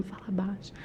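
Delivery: hushed whisper; practising speaking low. Retold or imitated. Rehearsing alone before the meeting, whispered. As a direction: drop right down to a whisper. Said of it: "Eu ficava treinando".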